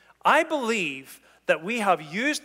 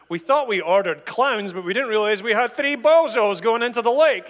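mocking party guest; 4 semitones higher, 6 dB louder than usual.

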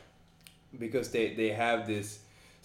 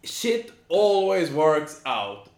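exasperated emphasis; 6 semitones higher, 7 dB louder, much slower than usual. Real, not acted. Exasperated rant about excuses.

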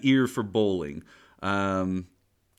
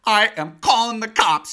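high, loud, slow outrage; angry landlady; 11 semitones higher, 9 dB louder than usual. Retold or imitated. The landlady threatening Roy with the cops, shrieking. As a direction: lay down the law, shrieking. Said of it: "It's like".

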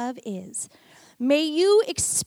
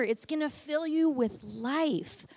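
ordinary delivery

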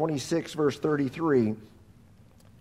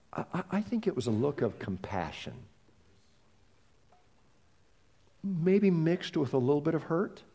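much softer, gentle and sincere; tender; 7 dB quieter than usual. Real, not acted. Preacher describing Jesus' compassionate look, hushed and tender. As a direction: speak it like a confession of love.

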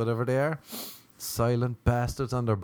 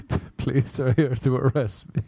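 words broken by real laughter; laughing; 3 dB louder than usual. Real, not acted. Friend on speakerphone as police arrive, laughing. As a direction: crack up while quoting your friend. Cracks up quoting his friend.

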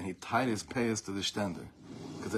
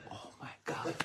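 unvoiced whispered oh my God; whispered shock; 5 semitones higher, 11 dB quieter than usual. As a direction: breathe it in a stunned whisper. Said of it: "He's like".

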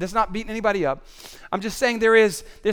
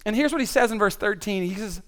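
ordinary talk, nothing marked

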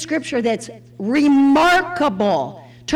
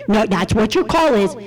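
stern nun; 7 semitones lower than usual. Retold or imitated. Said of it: "like, stern".

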